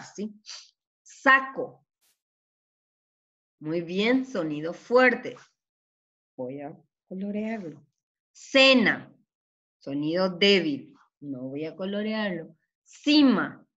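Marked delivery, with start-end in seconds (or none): 1.0-1.9 SACO higher, said deliberately; reading out a word. Shown as a demonstration. Teacher reading the exercise word to pupils, raised and deliberate. 8.3-9.2 CENA higher and louder; reading out a word. Shown as a demonstration. Teacher reading the exercise word to pupils, raised and loud. 12.8-13.6 CIMA higher and louder; reading out a word. Shown as a demonstration. Teacher reading the exercise word to pupils, raised and loud.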